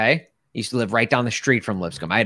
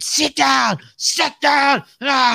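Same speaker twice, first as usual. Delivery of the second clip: much higher, full mock shout; imitated coach yelling; 11 semitones higher, 4 dB louder, much slower than usual. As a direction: imitate a coach screaming orders at a player. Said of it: "yelling at the dude".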